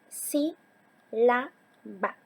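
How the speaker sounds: "sí, la, ba" as three separate beats; teacher dividing "sí, la, ba" into syllables. Shown as a demonstration. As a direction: split it into sí... la... ba, pausing after each. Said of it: "Sílaba se divide en".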